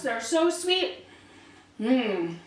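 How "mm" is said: chewing with mouth full. Real, not acted. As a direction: mm through a bite. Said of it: "talk with my mouth full".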